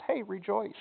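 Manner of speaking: a casual, offhand "Hey, rejoice", as if it were nothing; breezy. Retold or imitated. Pastor mimicking Paul's breezy advice to hurting people, lightly offhand. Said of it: "Paul just says".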